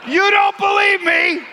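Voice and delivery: high, loud incredulous shout; mock indignation. Preacher to doubting audience, mock indignant. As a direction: shout it in pretend outrage.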